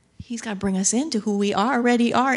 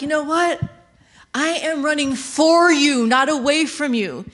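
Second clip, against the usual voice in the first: higher, louder eager reply; eager groom answer; 5 semitones higher, 4 dB louder than usual. Acted. Groom reassuring the bride he runs for her, raised eager voice. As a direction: tell her you're running toward her. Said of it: "so he's like".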